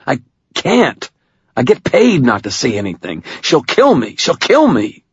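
high-pitched panicky pleading; frightened pleading. A narrator's reading. Carney fears his boss will kill him, panicky. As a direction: beg in fear for your life.

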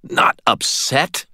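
high-pitched, slow, incredulous demand; indignant demand. A narrator's reading. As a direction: throw it back as an outraged question. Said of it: "I demanded".

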